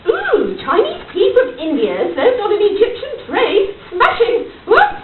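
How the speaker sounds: loud, thrilled posh exclamation; delighted gasp. Acted. Lady marvelling at a tea tray, thrilled and loud. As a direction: gush over the tea as a delight.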